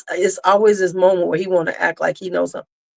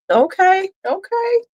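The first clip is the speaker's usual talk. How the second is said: high surprised okay; 11 semitones higher, slower than usual. Real, not acted. Reacting to chat comment.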